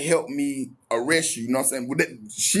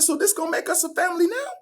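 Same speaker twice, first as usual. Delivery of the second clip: incredulous retort; faster than usual. Retold or imitated. Outraged at girlfriend who snitched.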